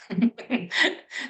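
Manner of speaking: short amused chuckle; brief laugh between remarks. Real, not acted. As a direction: chuckle after making the point. Laughs while explaining the pausing.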